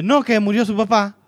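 high, loud blunt delivery of the bad news; blunt grandmother again. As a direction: blurt it out with no cushioning. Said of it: "pero sí dice". (la abuela lo dice de golpe, aguda y fuerte)

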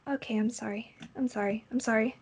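put-on soft, small voice of a scolded child; chastened and hushed; meek repeated apology. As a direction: murmur apologies over and over.

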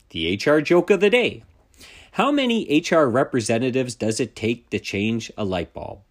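ordinary talk

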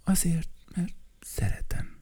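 high whispered answer; flower whispering why it stays; soft flower voice. Acted. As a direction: breathe it tenderly. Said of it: "szép virághangja volt".

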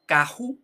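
"caju" with a breathy throat sound in the middle; "caju" with the r turned into h; Portuguese h-like R. Shown as a demonstration. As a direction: say "caju" with a guttural h, no trill. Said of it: "te toma la palabra".

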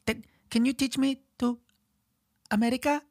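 put-on very high, halting little-kid voice. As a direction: imitate a small Chinese child learning English. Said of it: "they'd be like".

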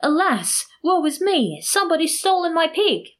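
high, wailing theatrical lament; narrator's acted outcry, high and distraught; shouted outcry. A narrator's reading. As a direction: cry out woe to all, pitched well up. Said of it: "make a great outcry, shouting".

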